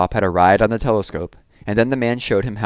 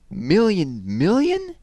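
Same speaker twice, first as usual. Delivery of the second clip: slow, high, breathless gasp of amazement; gasped astonishment; 11 semitones higher, much slower than usual. Acted. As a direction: let the number take his breath away. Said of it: "gasped Plop".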